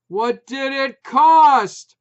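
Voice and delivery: very loud, slow, high; shriek. A narrator's reading. Putz shrieking for the price, very loud slow. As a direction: scream each word. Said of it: "Putz shrieked".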